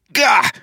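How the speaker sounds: high, loud frustrated outburst; exasperated "Gah!". A narrator's reading. Wayne fed up with the bad copy of his accent, loud frustrated cry. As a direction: burst out in exasperation.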